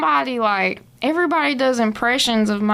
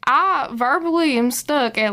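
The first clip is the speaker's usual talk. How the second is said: ordinary delivery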